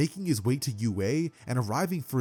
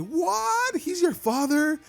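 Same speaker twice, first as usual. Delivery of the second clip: much higher, louder astonished cry; shocked disbelief; 4 dB louder, much slower than usual. Acted. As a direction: squeak it out in total surprise. Melissa shocked by news.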